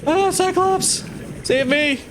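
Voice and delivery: high, frantic cry for help; mock panic. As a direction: yell for rescue in a hammy panic.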